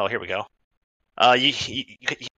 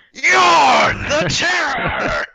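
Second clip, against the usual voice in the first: high, loud, very drawn-out roar; boastful shout; 6 dB louder, much slower than usual. Acted. Burly warrior answering who he is, boastful slow shout. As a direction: bellow your own name like a warlord. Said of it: "He looks at you and goes".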